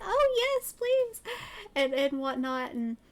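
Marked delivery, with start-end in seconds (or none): 0.0-1.2 high, squealed eager acceptance; gleeful mock squeal; retold excitement. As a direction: re-enact jumping at an invite, pitched way up. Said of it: "I was so excited when".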